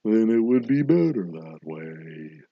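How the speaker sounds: very slow, drawn-out drowsy tone; dreamy and sleepy. Shown as a demonstration. Host demonstrating a sleepier delivery to stall, slow and dreamy. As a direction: stretch the words out as if drifting off. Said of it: "a little bit slower in a more dreamy".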